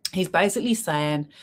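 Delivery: ordinary delivery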